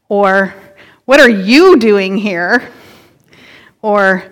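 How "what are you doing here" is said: slow question, hit the word "you" hard; stress on YOU. Shown as a demonstration. Preacher shows congregation how emphasis changes the question, stress on YOU. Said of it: "depending on what word you emphasize".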